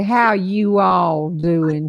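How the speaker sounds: "you all" drawn out slowly as two full words; separated "you all". Shown as a demonstration. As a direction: stretch "you all" instead of saying y'all. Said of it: "Don't ever say".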